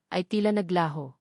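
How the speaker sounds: plain reading voice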